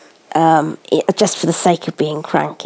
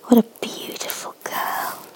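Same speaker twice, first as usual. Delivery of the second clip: tender whisper; 4 semitones higher, 8 dB quieter than usual. Real, not acted.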